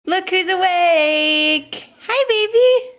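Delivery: high, loud, drawn-out sing-song. Real, not acted. Greeting her baby who woke.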